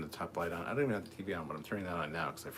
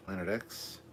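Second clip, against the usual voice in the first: ordinary talk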